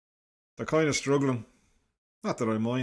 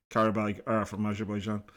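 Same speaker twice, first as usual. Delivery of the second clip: normal talking voice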